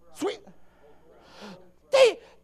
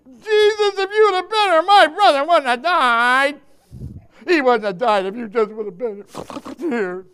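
loud lament; 8 dB louder than usual. Acted. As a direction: cry it out as grieving Martha, then drop. Acting Martha's grieving reproach to Jesus.

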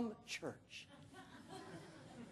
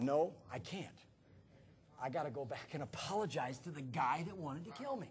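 low, soft inner voice; subdued resolve; 7 semitones lower, 10 dB quieter than usual. Retold or imitated. Pastor realizing he must go back and apologize, low and subdued. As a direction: think it aloud quietly. Recalls his sobering thought.

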